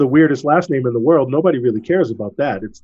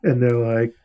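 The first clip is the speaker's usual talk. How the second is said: ordinary delivery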